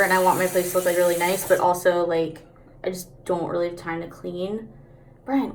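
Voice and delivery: ordinary talk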